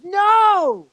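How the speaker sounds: loud, high retold "no"; shocked disbelief. Retold friend's shocked reaction.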